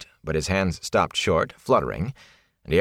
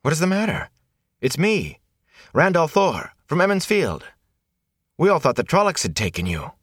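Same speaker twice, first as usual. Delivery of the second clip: put-on eager young man; 10 semitones higher than usual. Rand greets lost peddler.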